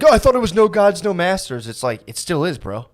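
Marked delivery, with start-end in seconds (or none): 0.0-1.7 high, mock-smug commenter voice. Mocking internet commenters, a high smug voice. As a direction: parody a smug know-it-all. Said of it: "And then they'll be like".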